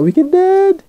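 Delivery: quick high-pitched puppet impression; Santana puppet voice. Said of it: "Santana puppet voice".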